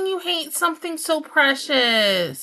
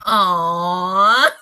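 loud, tearful aww; 6 semitones lower, 7 dB louder than usual. Real, not acted. Owner melting over kitten, loud aww.

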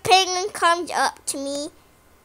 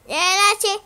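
ordinary delivery

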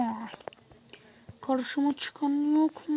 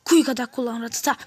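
usual speaking voice

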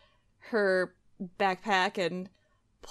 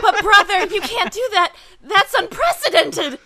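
high, loud, laughing protest; excited protest; 9 semitones higher, 8 dB louder than usual. Acted. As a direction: cry out in giggling alarm.

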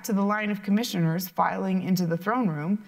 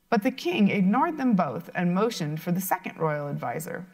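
usual speaking voice

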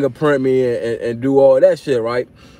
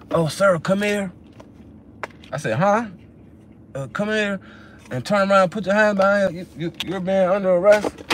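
lightly voiced trooper making an arrest; officer voice; 6 semitones higher than usual. Trooper stops the teller and arrests him, higher-pitched cop voice. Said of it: "So when he seen that".

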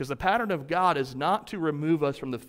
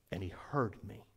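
much softer and lower, near a whisper; hushed; 9 semitones lower, 15 dB quieter than usual. Acted. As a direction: say it gently, in wonder. The verse repeated as a climax.

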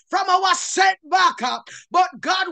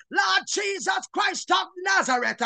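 ordinary delivery

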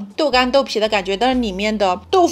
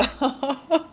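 short laugh; 5 semitones higher, 5 dB quieter than usual. Real, not acted. Laughing at the shop owner's remark.

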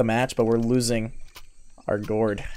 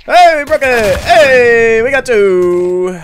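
loud, high victory cheer; excited shout; 8 semitones higher, 10 dB louder, much slower than usual. Real, not acted. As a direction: cheer it out, stretching the last words. Celebrating breaking the curse.

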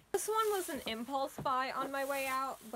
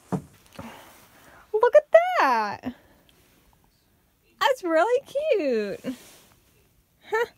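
very loud, high, drawn-out cooing; high-pitched, stretched-out burst of real delight; delighted squeal; 10 semitones higher, 15 dB louder, much slower than usual. Real, not acted. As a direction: gush over something adorable. Delighted at how the prop looks.

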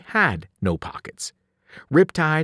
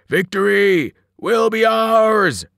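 loud, high, drawn-out giant's war cry; roar; 6 semitones higher, 6 dB louder, slower than usual. A narrator's reading. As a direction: roar it slowly like a triumphant cannibal giant. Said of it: "roared Joe Bob the Cannibal".

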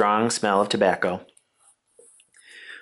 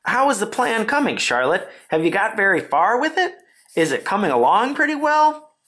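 high-pitched worried questioning; nervous questions climbing high; trembling, anxious; 11 semitones higher than usual. A narrator's reading. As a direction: press for news in a high, anxious voice. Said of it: "Wilbur was trembling again".

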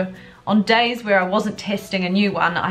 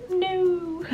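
dismayed no; 11 semitones higher, 3 dB quieter than usual. Real, not acted. Product crumbled in pan.

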